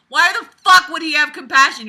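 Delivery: loud, high, incredulous challenge; exasperated outburst. Real, not acted. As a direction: blow up at the ridiculous writing. Incredulous outburst at the book's logic.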